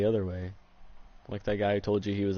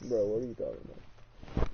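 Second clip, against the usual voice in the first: underwater; 5 dB quieter than usual. Real, not acted. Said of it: "You sound like blue, blue".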